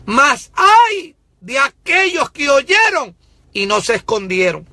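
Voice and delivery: very high, loud, drawn-out divine warning; voice of God. Acted. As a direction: thunder out God's woe as a prophet. Preacher voices God's warning.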